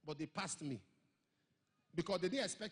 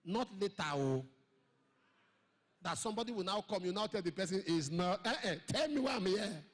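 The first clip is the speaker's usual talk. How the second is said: loud pleading; 9 dB louder than usual. Retold or imitated. Pleading with the Lord.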